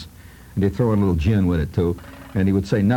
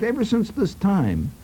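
ordinary talk, nothing marked

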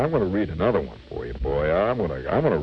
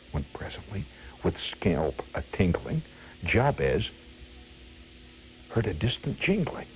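soft storyteller's hush; hushed suspense; 6 dB quieter than usual. Acted. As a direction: drop your voice for the distant sound. Suspense as Santa approaches.

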